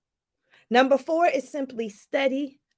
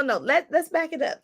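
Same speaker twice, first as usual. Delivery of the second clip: ordinary delivery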